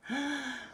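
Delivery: long, soft calming breath; real deep breath to settle down. Real, not acted. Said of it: "take a deep breath".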